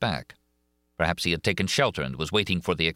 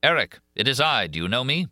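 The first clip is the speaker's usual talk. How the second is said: louder and higher, calling out; raised voice; 7 semitones higher, 4 dB louder than usual. A narrator's reading. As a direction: raise your voice to reach him. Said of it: "Then I raised my voice".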